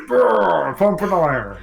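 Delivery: loud, high meathead grunt; gym bro grunt. Retold or imitated. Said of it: "just like".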